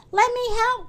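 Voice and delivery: high-pitched eager call to help; eager cry. A narrator's reading. Little Miss Helpful rushing to Mister Tall, eager cry. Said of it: "cried Little Miss Helpful".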